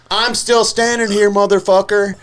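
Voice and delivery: defiant. Retold or imitated. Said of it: "he was like".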